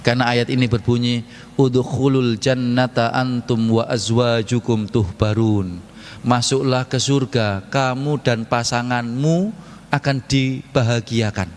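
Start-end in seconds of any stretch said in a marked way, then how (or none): 1.5-5.9 slow, measured recitation of the verse; Quran recitation. Real, not acted. Preacher recites the verse to the congregation, slow and measured. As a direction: recite it slowly and reverently.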